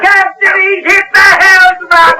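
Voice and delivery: high, shouted, panicked; alarmed cry. Acted. Sailor cries out to the captain that the helmsman is hit, alarmed shout. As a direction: yell the bad news across the deck.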